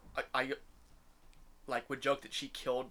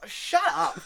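higher, louder shut-up, half laughing at him; mock snap; 8 dB louder than usual. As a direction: cut him off with exaggerated disgust. Snapping at the annoying guy.

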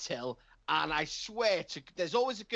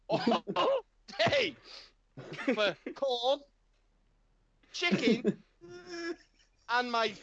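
losing it; 7 semitones higher than usual. Retold or imitated. To the cashier about his daughter's chicken, a high tearful rant. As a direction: whine it out like a broken man. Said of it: "And I'm like".

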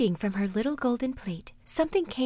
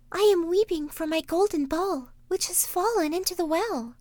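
princess voice; 9 semitones higher than usual. Acted. Princess answering the frog: high voice. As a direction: lift the voice up high for the young princess. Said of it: "she said".

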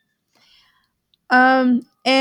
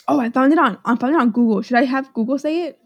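ordinary delivery

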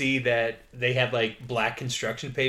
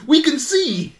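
high, loud, laughing accusation; mock accusing guard; 12 semitones higher, 8 dB louder than usual. Guard accusing the couple of eating the fruit, mock outrage through laughter. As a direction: ham up a guard catching them red-handed.

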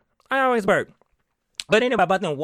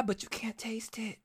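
slow whisper, like a secret; confiding whisper; 3 semitones higher, 14 dB quieter than usual. Real, not acted. Host confiding to viewers about strong wine, whispered. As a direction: drop to a whisper.

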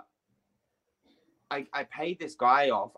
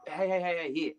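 higher, softer repeated calls for attention; lightly voiced woman butting in to help; imitated woman; 5 semitones higher, 4 dB quieter than usual. Woman offering help over his shoulder, lightly imitated. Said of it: "I hear this Chinese woman. She goes".

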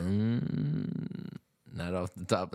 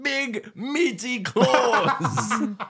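high, very drawn-out cartoon taunt; cartoon arguer; 13 semitones higher, 4 dB louder, much slower than usual. One dude arguing with Mister Krabs, exaggerated taunt. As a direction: stretch every word high in a put-on voice. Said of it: "arguing with Mister Krabs".

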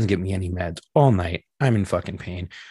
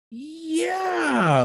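high, drawn-out reluctant yeah; awkward confirmation. Retold or imitated. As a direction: stretch it out high, breaking bad news. Lady awkwardly confirms bad news.

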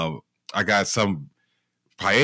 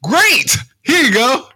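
much higher and louder, over-bright chirp; fake cheerful; 7 dB louder, slower than usual. Handing the boss his resignation letter, fake cheerful. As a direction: hand over the resignation with sugary fake glee. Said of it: "I was like".

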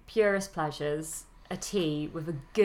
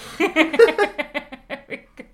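loud laugh; 10 semitones higher, 7 dB louder than usual. Real, not acted. Laugh after Deadpool remark.

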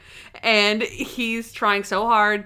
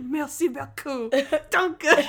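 higher-pitched imitation, breaking into laughter; mock aggressive thanks; 5 semitones higher, slower than usual. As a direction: jab out the foreign thanks, amused. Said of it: "It's so aggressive".

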